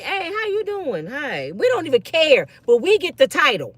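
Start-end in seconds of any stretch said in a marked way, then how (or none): 0.0-1.5 much softer, slow, friendly hello; lightly voiced nice greeting; soft polite greeting. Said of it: "Be like".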